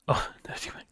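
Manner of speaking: a snickering aside whispered to chat; whispered pun. Real, not acted. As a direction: giggly whisper on nerdy wank. Muttering a rude pun on Erdogan.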